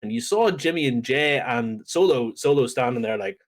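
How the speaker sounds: ordinary talk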